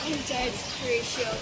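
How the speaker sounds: faint dying voice. Acted. Dying prince speaks.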